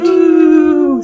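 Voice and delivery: high, loud celebratory whoop; excited cheer. Real, not acted. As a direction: cheer the anniversary. Celebrating anniversary episode.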